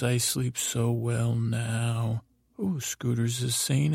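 ordinary delivery

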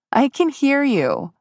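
high call through a door. Acted. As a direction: call out, insisting. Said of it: "she called".

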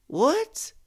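high, rising cry of disbelief; shocked cry. Acted. Woman hearing her boyfriend is in jail, crying out in shock. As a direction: cry it out, pitch leaping up. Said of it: "I cried".

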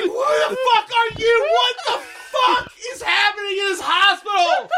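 high, loud laughing disbelief; mock outraged yell; incredulous shout. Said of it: "You're like".

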